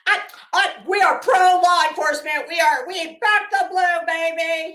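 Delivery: hammed-up blue-backer, shouting; loud mock cheer for the police; mock loud supporter. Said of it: "to tell them how".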